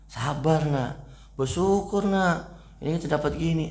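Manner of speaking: high, coaxing parent voice; parent admonishing child. Acted. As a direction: play a parent preaching patience to a child. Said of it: "Orang tuanya nyuruh".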